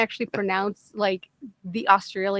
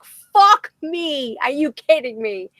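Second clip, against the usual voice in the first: higher, louder outburst, easing off; exasperated disbelief; 6 semitones higher, 4 dB louder, slower than usual. Retold or imitated. Retelling reaction to a voice-acting accent request, exasperated disbelief. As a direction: cry out in retold dismay.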